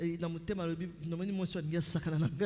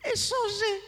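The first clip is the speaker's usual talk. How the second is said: high shrieking laugh; a loud, high cackle; burst of laughter; 9 dB louder than usual. Retold or imitated. Loud laughing outburst in story.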